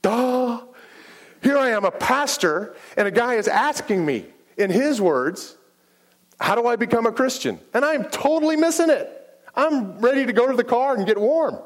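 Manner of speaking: self-mocking duh. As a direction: roll your eyes at your own blindness. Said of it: "I was like, "Duh".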